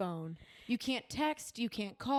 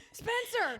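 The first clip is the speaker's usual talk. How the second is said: high-pitched calling out; yelling names; 10 semitones higher than usual. Retold or imitated. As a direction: toss guessed names across the bar. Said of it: "I'm just yelling, yelling names".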